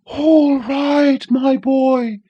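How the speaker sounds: a boisterous wind shouting; high loud boisterous wind; shrieking wind. A narrator's reading. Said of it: "it shrieked".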